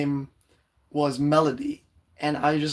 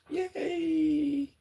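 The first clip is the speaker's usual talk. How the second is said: tiny high-pitched cheer, much higher and softer; squeaky yay; 12 semitones higher, 5 dB quieter than usual. Shown as a demonstration. Live streamer testing her returning voice, squeaky high yay. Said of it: "my voice is coming back".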